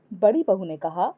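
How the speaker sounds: usual speaking voice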